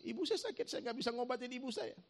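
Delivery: high-pitched mock weeping; imitated crying. Retelling a tearful ojek driver on his sick mother, imitated crying. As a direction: wail it lightly, imitating the tearful son. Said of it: "Terus kenapa kamu nangis?".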